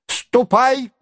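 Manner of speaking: sudden high-pitched order; high, abrupt old prince; abrupt old man's dismissal. A narrator's reading. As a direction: send him off suddenly, voice up high. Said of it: "вдруг сказал он".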